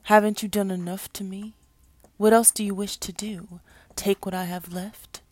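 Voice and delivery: screaming beast. A narrator's reading. Fallen angel to Jesus who judges him, screamed accusation. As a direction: cry out in full-voiced accusation. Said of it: "the beast screamed".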